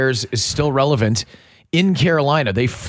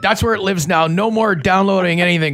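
raised-pitch mock ruling; dad voice; 5 semitones higher than usual. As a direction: lay down the law like a fed-up dad. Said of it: "This is my dad voice".

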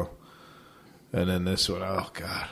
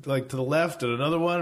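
usual speaking voice

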